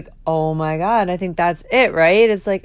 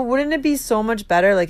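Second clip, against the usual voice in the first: normal talking voice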